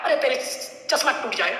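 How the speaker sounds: ordinary talk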